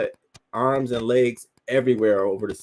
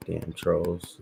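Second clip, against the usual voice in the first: usual speaking voice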